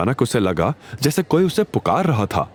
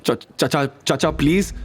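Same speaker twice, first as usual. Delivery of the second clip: insisting; 7 semitones higher than usual. A narrator's reading. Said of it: "थोड़ा और जोर दिया".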